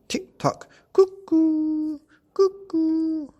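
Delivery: very high, loud cuckoo calls; cuckoo clock. Acted. Narrator voicing the clock striking, high cuckoo calls. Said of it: "went the living room clock".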